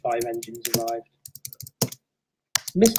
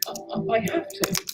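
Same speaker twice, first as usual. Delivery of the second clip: whispered resolve; 6 semitones higher, 6 dB quieter than usual. Acted. Resolving to kill her.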